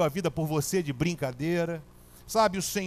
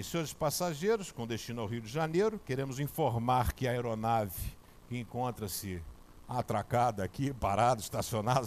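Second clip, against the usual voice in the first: airport announcer; 6 semitones lower, 5 dB quieter than usual. Retold or imitated. Telling the church about the airline's gate announcement, mock announcer voice.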